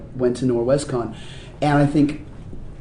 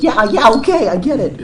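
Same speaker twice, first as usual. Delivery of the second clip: high, louder, eager-to-placate agreement; retold hasty compliance; 9 semitones higher, 4 dB louder than usual. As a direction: give in quickly to someone bigger, playfully acted. Child giving in to towering dad.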